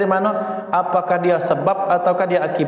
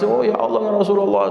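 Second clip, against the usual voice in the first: mock crying; 4 semitones higher, faster than usual.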